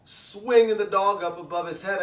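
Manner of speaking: ordinary talk, nothing marked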